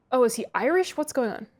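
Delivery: ordinary delivery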